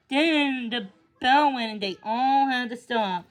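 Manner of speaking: ordinary delivery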